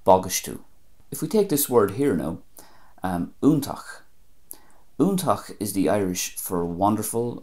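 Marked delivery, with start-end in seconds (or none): 0.1-0.6 "bagaistú" as BAG-ish-too; Ulster pronunciation. Shown as a demonstration. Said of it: "and Ulster". 3.4-5.6 "úntach" as OON-takh; Munster pronunciation. Shown as a demonstration. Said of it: "I'm pronouncing that the Munster way".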